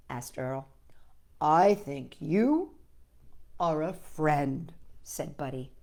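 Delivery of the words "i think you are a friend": tender answer. Acted.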